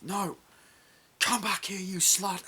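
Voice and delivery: high, loud imitation of a yell; mock angry shout. Acting out a film quarrel, high mock shouting. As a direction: call someone back in a high mock rage.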